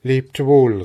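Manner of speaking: laut und höher gerufen: „lebt wohl“; „lebt wohl“ laut in die Ferne rufen; lauter Ruf. Acted. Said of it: "rief sie". (Maren ruft der Regentrude laut „lebt wohl“ nach)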